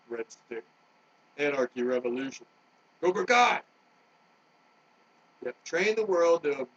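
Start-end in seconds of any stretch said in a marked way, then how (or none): 3.0-3.6 shouted cry. Real, not acted. Shouted battle cry in rant.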